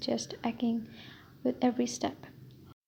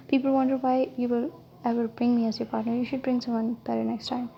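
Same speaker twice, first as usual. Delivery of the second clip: usual speaking voice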